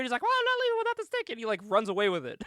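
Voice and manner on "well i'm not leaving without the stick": rushed, high-pitched comic protest; mock indignant refusal. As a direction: imitate a flustered cheat, racing through it up high. Acts out manager refusing to leave.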